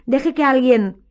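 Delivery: high, loud, drawn-out plea; shouting. A narrator's reading. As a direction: call out urgently until cut off. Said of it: "gritó Harry".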